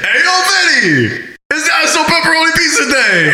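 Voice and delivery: high-pitched mock shout across a shop; calling out. Acts calling out to pizza worker.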